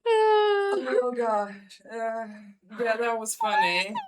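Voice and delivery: loud laughing reaction. Real, not acted. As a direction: laugh while talking.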